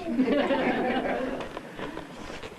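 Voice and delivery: a high burst of laughter. Real, not acted. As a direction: laugh at your own joke. Laughs after a joke.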